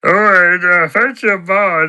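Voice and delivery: high, loud character impression; Patter. Character takes up his mate's offer, high loud impression. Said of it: "I can do an impression of Patter".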